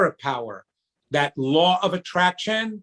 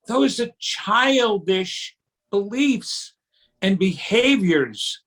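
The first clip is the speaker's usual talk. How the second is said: ordinary talk, nothing marked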